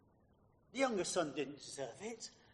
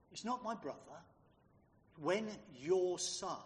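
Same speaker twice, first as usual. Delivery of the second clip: ordinary delivery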